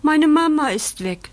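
high, lightly tearful puppy; sobbing. A narrator's reading. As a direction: lament with a mild mock sob. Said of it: "schluchzt Bandit".

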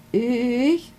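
high, startled little stammer. A narrator's reading. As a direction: caught off guard, stammer it out high. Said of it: "stottert Milo". (hoch und verdutzt gestottert)

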